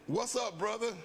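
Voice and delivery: bright, high call of greeting; friendly greeting. Retold or imitated. Airman on the plane greeting a downcast stranger, high and friendly. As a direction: greet a fellow believer with a lift. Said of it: "one of the guys say".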